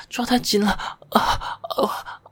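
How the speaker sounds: ordinary delivery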